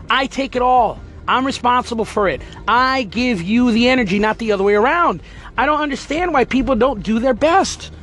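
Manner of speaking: normal talking voice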